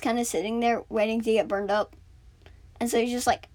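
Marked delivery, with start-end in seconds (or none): none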